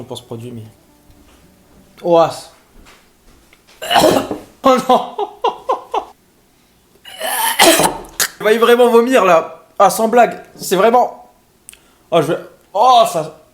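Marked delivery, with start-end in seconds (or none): 3.8-4.4 gagging cough. Real, not acted. Gagging at Vegemite smell. 7.1-8.4 gagging cough. Real, not acted. Gagging at Vegemite smell. 8.4-13.4 disgusted gagging. Real, not acted. Nauseous reaction to smell.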